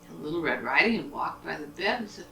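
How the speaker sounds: ordinary delivery, nothing marked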